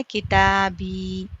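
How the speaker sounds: किताबी said very slowly, ता and बी held long; slow stretched vowels. Shown as a demonstration. Teacher models the correct slow reading. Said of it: "याए मद्दा किताबी".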